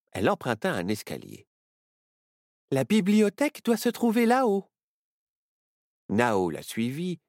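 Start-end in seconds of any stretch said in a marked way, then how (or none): 2.7-4.7 Myrtille confident. Acted. Myrtille leads.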